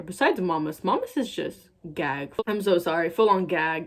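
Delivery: ordinary delivery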